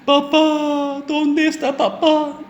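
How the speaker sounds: put-on high, childlike wail for his dad; crying little boy. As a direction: call out like a lost, tearful small child. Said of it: "comenzó a llorar".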